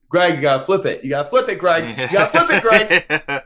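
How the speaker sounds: comically frantic yell; urgent yelling. Retold or imitated. Replays yelling at worker to flip.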